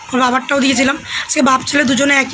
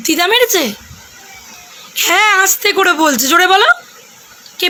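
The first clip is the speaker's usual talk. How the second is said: put-on coaxing a child; 3 semitones higher than usual. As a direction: playfully quiz the child, bright and coaxing. Mother coaxing child about bruise.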